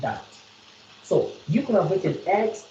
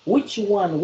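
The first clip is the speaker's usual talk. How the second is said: ordinary talk, nothing marked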